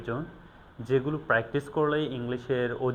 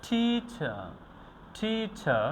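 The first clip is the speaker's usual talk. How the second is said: high, drawn-out "টি", weak falling "চার" in "teacher"; stress on first syllable; 7 semitones higher, much slower than usual. Shown as a demonstration. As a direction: lean on the first half of "teacher". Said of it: "উইক উচ্চারণ করব এবং এখানে strong". ("টিচার"-এ "টি" উঁচু ও জোরালো, "চার" হালকা)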